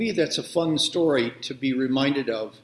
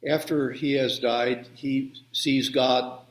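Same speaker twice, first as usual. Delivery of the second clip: ordinary talk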